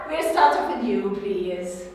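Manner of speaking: normal talking voice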